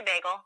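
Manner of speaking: bagel said with the Canadian ay vowel, like baygel. Shown as a demonstration. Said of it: "we say".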